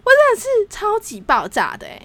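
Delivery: high, stressed 真 in outrage; exploding with anger. Real, not acted. Telling the co-host how angry she still is, fuming. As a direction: hit 真 hard, fuming.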